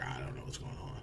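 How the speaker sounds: hushed, low, near-whispered muttering; low whispery aside to oneself; confused mumble. Retold or imitated. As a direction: mutter it under your breath, lost. Imitates own lost, muttered confusion.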